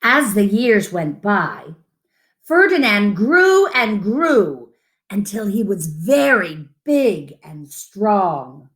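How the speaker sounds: unhurried picture-book read-aloud; reading to children. Acted. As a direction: read it to little ones, savouring each line.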